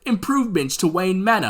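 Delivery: badly faked British butler; mock-British butler making a polite suggestion; terrible British accent. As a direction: put on a hammy British accent. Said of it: "that was a terrible accent".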